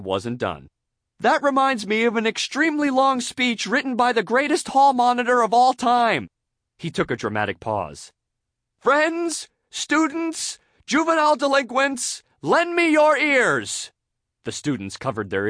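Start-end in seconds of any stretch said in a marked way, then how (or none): 1.2-6.3 high, loud, self-important speechifying; pompous SpongeBob oratory. A narrator's reading. SpongeBob not done with speech, pompously orating. 8.8-13.9 very high, declaimed salutation; dramatic oratory. A narrator's reading. Student orator addressing classmates, declaiming dramatically.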